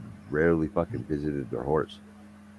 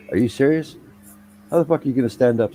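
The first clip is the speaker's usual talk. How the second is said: high, raised-voice mock disbelief; acted incredulous questioning, pitched up; incredulous boss; 8 semitones higher, 5 dB louder than usual. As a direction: imitate a boss who can't believe it.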